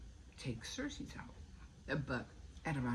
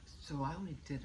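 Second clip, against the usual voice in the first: usual speaking voice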